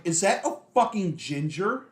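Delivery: Ashley. Retold or imitated. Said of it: "So loud".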